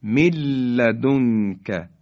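slow من لدنک as milladunka; nun merged into lam. Shown as a demonstration. Tajweed teacher demonstrating idgham, doubled l in من لدنک. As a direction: drop the n in من لدنک and hold the l.

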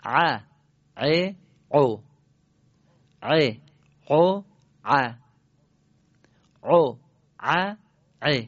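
throaty ع and عو in groups of three separate syllables; thick Arabic ayn on ع and عو, grouped by threes; ayn drill in threes. Shown as a demonstration. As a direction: say ع, ع, عو detached, then pause after the third. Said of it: "حالا ستایی".

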